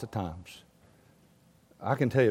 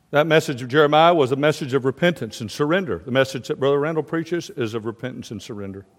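ordinary talk, nothing marked

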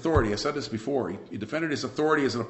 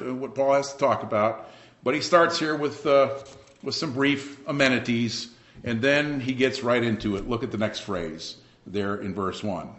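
normal talking voice

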